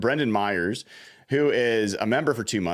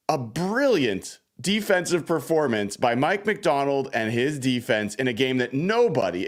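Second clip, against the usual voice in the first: normal talking voice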